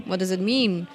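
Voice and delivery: usual speaking voice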